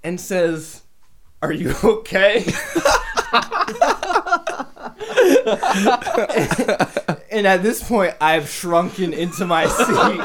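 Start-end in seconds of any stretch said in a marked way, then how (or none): 1.4-2.4 retold question breaking into laughter; amused retelling. Storyteller quoting a classmate's awkward question, cracking up. 2.5-7.2 long high-pitched laughing fit; big laugh. Real, not acted. Storyteller cracking up at the awkward handshake, high laughing fit.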